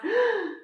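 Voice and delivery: sharp high gasp of alarm; surprised gasp. Acted. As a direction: gasp as if remembering something forgotten. Narrator gasps at the realisation. (suspiro de susto)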